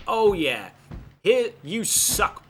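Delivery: loud, drawn-out mock taunt; mock Hulk Hogan. Player mimicking the rival butting into his business, taunting. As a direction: imitate a wrestler's big, slow jeer. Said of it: "literally just being like".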